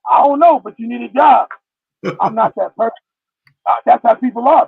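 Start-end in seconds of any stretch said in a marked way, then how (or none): 0.1-1.5 raised, louder mock scolding; mock nagging. Imitating people who nag the jobless, mock scolding. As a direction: badger them like a pushy critic.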